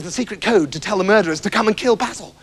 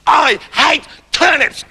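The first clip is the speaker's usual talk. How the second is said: slow, shouted tantrum; 8 semitones higher, slower than usual. Acted. Septimus raging at the king in a tantrum. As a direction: stamp out each word in a rage. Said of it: "in a temper tantrum".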